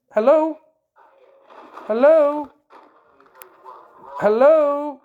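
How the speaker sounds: loud repeated hello, waiting for a reply; calling out on phone. Real, not acted. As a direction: call down the phone for someone to answer. Calling into silent phone line.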